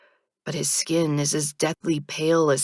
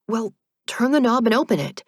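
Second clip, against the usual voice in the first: impatient; 5 semitones higher, 4 dB louder than usual. A narrator's reading. Heroine impatient.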